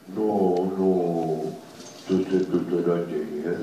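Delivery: loud, low grumbling dog; big dog voice. Acted. Dog Fík grumbles at the fainted neighbour, loud.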